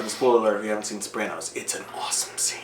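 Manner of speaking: hushed, whispered aside. Real, not acted. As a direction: whisper it like a secret. Spoiler aside, voice drops away.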